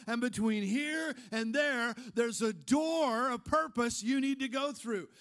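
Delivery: full-voiced preaching with pointed questions. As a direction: drive the questions home to the congregation. Preaching build to congregation.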